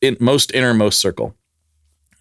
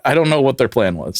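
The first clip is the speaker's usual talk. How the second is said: usual speaking voice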